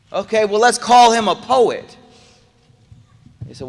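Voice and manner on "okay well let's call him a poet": high, loud eager suggestion; plotting chiefs. Retold or imitated. Preacher voicing a chief's eager idea, high and loud. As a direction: pitch it up like a schemer. Quraysh leaders voiced in story.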